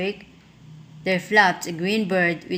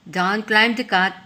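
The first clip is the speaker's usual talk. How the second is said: ordinary delivery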